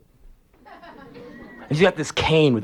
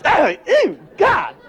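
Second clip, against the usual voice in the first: high squealing disgust; mock-disgusted shriek; 3 dB louder, much slower than usual. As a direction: recoil in mock horror, up high. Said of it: "I, like".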